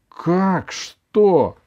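flaring up. A narrator's reading. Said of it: "вспылила бабушка".

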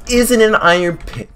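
high, loud excited cry; excited shout. Real, not acted. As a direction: shout it out with glee. Excited find.

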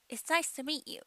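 "meet you" as mee-you with a tiny stop; dropped T. Shown as a demonstration. Teacher showing another variant, "meet you" without the T. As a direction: swallow the T in "meet" before "you". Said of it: "Tをあまり発音しません".

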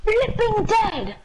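high, drawn-out despairing wail; slow, high cry of defeat. Real, not acted. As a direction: wail as the game kills you. Dies in game, wails.

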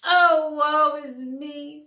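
slow, loud, high lament; melodramatic wail. A narrator's reading. Fox bemoaning his fate to Flossie, slow loud wail. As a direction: holler the woe dramatically. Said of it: "Fox hollered".

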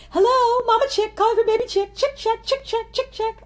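high, loud sing-song mom on the phone; imitating her mom. Daughter imitating her mom calling on the phone, high sing-song. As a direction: chirp it up high like a mother hen calling. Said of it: "I'm now about to imitate my mom".

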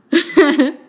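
loud high laugh; excited laugh. Real, not acted. Vlogger laughing at the bargain, loud giddy laugh.

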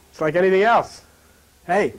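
ordinary talk, nothing marked

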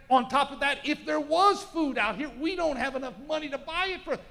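loud, high panicked objection; protesting disciples. Acted. Disciples shouting their doubts at Jesus, loud and high. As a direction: shout it can't be done, desperate.

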